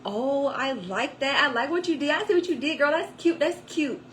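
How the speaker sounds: high, loud admiring praise; parking-lot lady raving about the look; gushing stranger. Retold or imitated. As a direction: gush over the outfit like a sassy stranger. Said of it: "she was".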